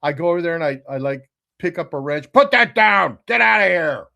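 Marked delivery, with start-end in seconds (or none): 2.3-4.1 loud, higher-pitched angry dad shout; acted-out scolding shout; imitating yelling dad. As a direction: yell like a dad chasing off a kid. Voices his dad yelling at him.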